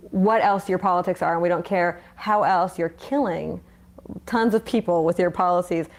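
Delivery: usual speaking voice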